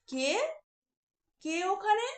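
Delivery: put-on girl calling out who is there; called out. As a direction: call out nervously into the garden. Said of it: "সে তখন চেঁচিয়ে বলল".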